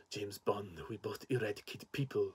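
low, quiet, raspy villain voice; raspy Safin voice. Retold or imitated. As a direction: rasp it out like a menacing villain. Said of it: "the way he talks like this with this very raspy voice".

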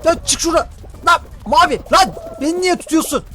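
high-pitched scolding at an opponent; indignant yelling. Real, not acted. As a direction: yell at someone who keeps grabbing you. Scolding an opponent who grabs the player. (tiz sesle öfkeli çıkışma)

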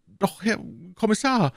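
high-pitched, timid objection. A narrator's reading. Prisoner Bonacieux timidly contradicting the commissioner, timid and high-pitched. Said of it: "erwiderte Herr Bonacieux zaghaft".

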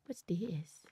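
hushed curious whisper; soft whispered question. Real, not acted. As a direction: breathe it out unvoiced, curious. Exploring quietly in game.

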